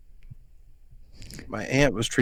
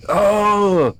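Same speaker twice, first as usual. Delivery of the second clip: high, loud "oh" as a dog throwing a fit; imitated dog outburst; 10 semitones higher, 6 dB louder than usual. Owner retelling his dog's fit at him, imitated outburst. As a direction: yelp it out like a fussing dog. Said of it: "kinda threw a fit".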